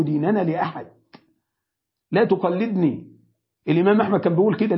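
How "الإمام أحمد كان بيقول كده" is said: ordinary delivery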